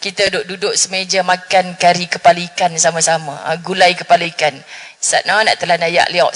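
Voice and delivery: ordinary talk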